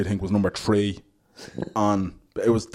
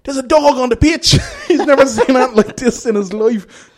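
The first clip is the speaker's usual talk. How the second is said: high, loud, laughing mock commentary; excited commentator; 13 semitones higher, 9 dB louder than usual. Sports commentator gone wild over a dog on the pitch, high and loud. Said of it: "the commentator is like".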